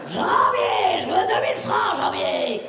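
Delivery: put-on gruff shouted old film star; Jean Gabin. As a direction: bark it like a gruff old actor. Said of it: "je pouvais imiter Jean Gabin".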